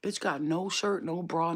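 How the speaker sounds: usual speaking voice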